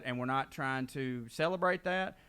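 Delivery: normal talking voice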